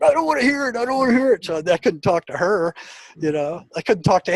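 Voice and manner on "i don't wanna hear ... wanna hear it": higher-pitched, brushing it off twice; dismissive wife. Retold or imitated. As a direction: mimic the wife waving the topic away. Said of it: "she just, you know, like".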